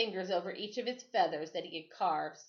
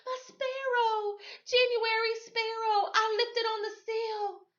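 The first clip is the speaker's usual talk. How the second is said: put-on upset child; 3 dB louder than usual. A narrator's reading. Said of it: "Sadie whispered".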